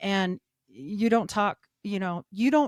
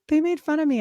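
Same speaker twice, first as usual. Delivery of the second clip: mock crying stepdaughter; 8 semitones higher, 5 dB louder, faster than usual. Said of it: "she was, like, crying and she's like".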